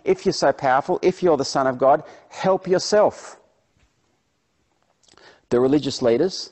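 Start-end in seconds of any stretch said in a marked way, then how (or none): 0.0-3.4 loud, goading taunt; emphatic mock dare from a heckler; mocking crowd. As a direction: jeer a challenge, drawing out the last words. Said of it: "the crowds are mocking him".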